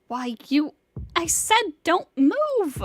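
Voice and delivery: furious slow yell; angry shout. Acted. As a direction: roar the order to freeze. Vampire girl threatening him.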